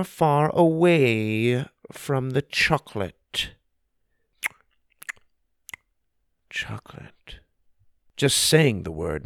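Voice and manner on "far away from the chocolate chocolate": craving whisper. Acted. Word makes him smack lips.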